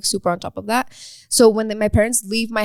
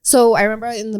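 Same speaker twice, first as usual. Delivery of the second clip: ordinary talk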